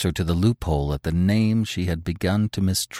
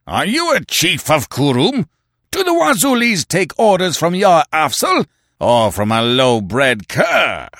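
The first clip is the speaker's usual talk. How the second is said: higher, louder furious chieftain; a chief enraged at a challenge to his authority; shouting down the upstart in rage; wrathful; 10 semitones higher, 7 dB louder than usual. A narrator's reading. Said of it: "his face purpling with wrath".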